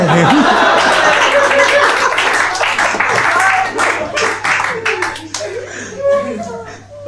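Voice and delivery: long, high burst of real laughter; big laugh. Real, not acted. Comedian bursting out laughing at his own story. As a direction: cracks up at his own punchline.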